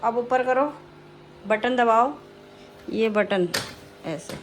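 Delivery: ordinary talk, nothing marked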